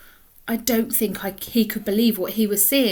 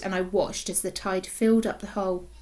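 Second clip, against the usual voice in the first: usual speaking voice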